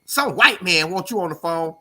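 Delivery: put-on young friend calling dad. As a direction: shout for his dad across the house. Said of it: "He called his daddy and he said".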